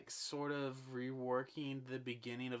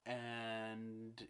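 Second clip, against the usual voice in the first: normal talking voice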